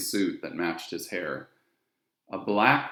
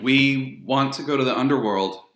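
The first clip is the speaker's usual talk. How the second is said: louder, higher, determined; bold demand; 5 semitones higher, 7 dB louder than usual. Acted. Annabeth facing the ferryman, bold and loud. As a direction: blurt it out bravely. Brave girl stating demand.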